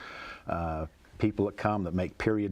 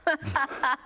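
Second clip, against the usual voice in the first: laugh. Real, not acted. Laughs after the poem's punchline.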